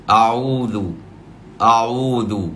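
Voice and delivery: أعوذ said with no ain, like "a'udh" with a plain vowel; imitating a reciter who drops the ain; ain turned to alif. Shown as a demonstration. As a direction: demo the wrong ain that slides into alif in أعوذ. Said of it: "تقريب العين فتصبح ألفًا".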